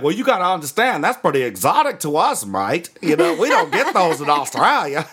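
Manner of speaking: high, emphatic mock Australian; Australian accent. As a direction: explain it as a hammed-up Aussie mate. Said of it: "One of those dudes from Australia".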